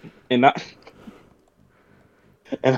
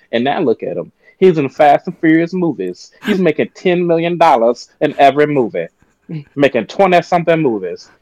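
ordinary delivery

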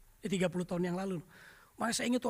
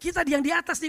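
ordinary delivery

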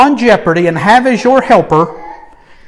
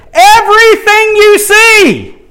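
big shout; 13 semitones higher, 6 dB louder, slower than usual. Acted. Building to a climax.

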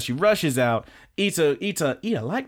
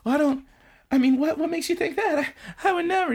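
high, stammering denial; very high blushing girl; flustered anime girl; faster than usual. Retold or imitated. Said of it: "She's like".

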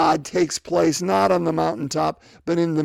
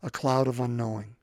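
soft, low invitation; intimate call; 4 semitones lower, 4 dB quieter than usual. Pretend. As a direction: draw them in gently.

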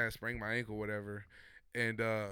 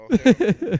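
"hell" yelled high and much louder; much louder, higher panicked repeat of "hell no"; 11 semitones higher, 20 dB louder than usual. Retold or imitated. Scared quote, repeated louder.

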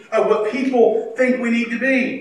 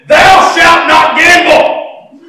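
much louder, higher, slow pronouncement; mock scripture proclamation; 9 semitones higher, 12 dB louder, much slower than usual. Wife insisting the Bible bans gambling, a loud slow proclamation. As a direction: thunder it like a fake commandment. Wife swore a verse existed.

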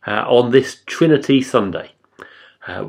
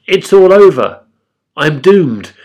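loud, raised cry of doom; dismayed outcry; 5 semitones higher, 10 dB louder than usual. A narrator's reading. Prophet seeing the Lord, crying out in dismay. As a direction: cry out as if all is lost. Prophet despairs in scripture reading.

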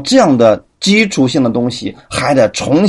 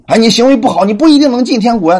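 mock warning; 8 semitones higher, faster than usual. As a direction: act out a busybody scaring a believer. Preacher voices a warning critic.

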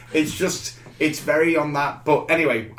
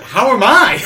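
excited disbelief; 7 semitones higher, 8 dB louder than usual. Retold or imitated. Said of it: "It's like".

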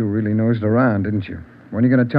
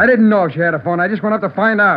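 agitated protest; 6 semitones higher, 3 dB louder than usual. Acted. Suspect pressed about the phone.

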